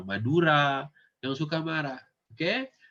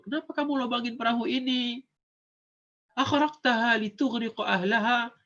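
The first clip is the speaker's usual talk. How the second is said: Musa asks indignantly; 9 semitones higher than usual. Acted. Musa's question to Khidir voiced.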